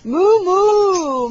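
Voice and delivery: high, loud drawn-out moo; exaggerated playful cow imitation. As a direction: moo big to amuse a toddler. Mooing back to toddler.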